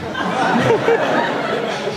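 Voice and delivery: breathy high laugh. Real, not acted. Laughs at himself.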